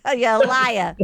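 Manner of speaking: put-on Boston accent, R dropped on liar; mock Boston accent. Boston kid confronting her cousin about Hollywood, mock accent. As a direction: imitate a Boston kid's accusation. Quoting Boston child's accusation.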